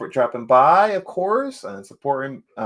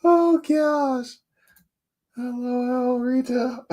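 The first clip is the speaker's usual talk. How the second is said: high squeal; 13 semitones higher, much slower than usual. Real, not acted. Greeting Rita in a squeal.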